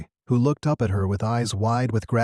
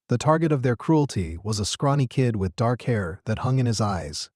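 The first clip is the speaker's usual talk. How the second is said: ordinary delivery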